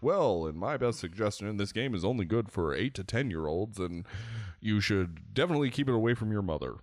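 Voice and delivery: prim, pompous reviewer voice, rushing the end; stuffy game reviewer. Pretend. Podcaster parodies a typical game review show, pompous mock reviewer. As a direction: play a self-important reviewer, speeding up at the close.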